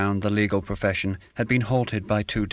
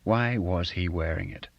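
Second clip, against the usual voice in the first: ordinary delivery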